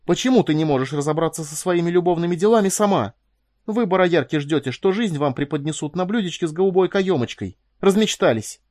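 loud, high-pitched scolding tirade; uncontrollable anger. A narrator's reading. Mistress berating the young woman over her love affairs, angry. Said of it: "чей гнев сейчас неудержимо брызгал".

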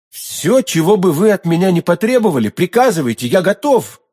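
ardent outcry. A narrator's reading. D'Artagnan pledging anything to milady, ardent outcry. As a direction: cry it out with eager devotion. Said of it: "вскричал Д'Артаньян".

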